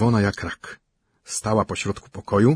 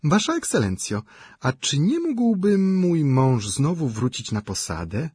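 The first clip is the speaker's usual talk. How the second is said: sweet, coy smile; 7 semitones higher than usual. A narrator's reading. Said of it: "ze słodkim, delikatnym, a nawet zalotnym uśmiechem".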